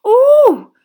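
shrill startled squeal; high squeal of fright. A narrator's reading. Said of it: "squealed Lavender Brown".

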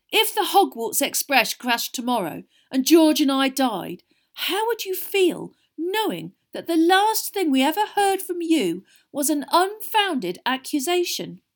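high, loud, melodramatic hurt; guilt-trip mother with hammed-up injury; mock pained. A narrator's reading. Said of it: "a pained look on his face".

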